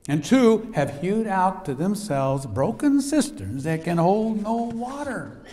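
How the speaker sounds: high, loud, slowing pulpit proclamation; preaching scripture. Acted. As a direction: thunder the warning to the church.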